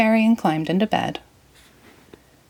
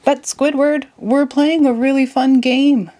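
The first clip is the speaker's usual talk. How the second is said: Patrick pleading; 8 semitones higher, 4 dB louder than usual. Acted. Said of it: "Patrick explained".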